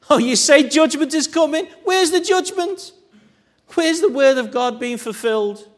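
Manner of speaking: high, loud jeering taunt with a laugh; mocking sneer. Mockers taunting Jeremiah with a high, loud, laughing jeer. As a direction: scoff at the prophet like a crowd of mockers. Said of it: "they were mocking him".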